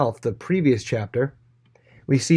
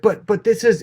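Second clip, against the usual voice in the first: high-pitched pleading protest; a servant squeaks out a plea, higher; 9 semitones higher, 4 dB louder than usual. Retold or imitated. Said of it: "the guy's like".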